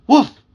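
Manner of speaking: loud, high dog bark, spoken; emphatic woof. A narrator's reading. Puppy's whole speech comes out as one loud, high woof. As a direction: bark it out sharply. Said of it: "Although it came out".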